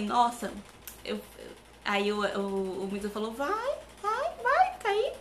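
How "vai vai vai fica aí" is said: very high-pitched imitation of a friend; mock urging. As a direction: goad in a squeaky put-on high voice. Imita o amigo mandando ir. (imitando provocação em voz aguda)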